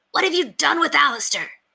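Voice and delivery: high, small knightly demand; little figure's high-pitched challenge; tiny knight calling out. A narrator's reading. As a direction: call out as a tiny, affronted knight. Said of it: "he called out, his voice a tiny croak in the courtyard".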